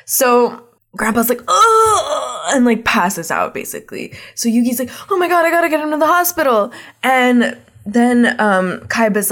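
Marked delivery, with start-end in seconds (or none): 1.5-2.5 long high collapsing groan; mock dying groan. Grandpa collapsing in the retelling, mock groan. 5.1-6.7 high, fast panicky rush; mock panic. Yugi needing the hospital for Grandpa, mock panic.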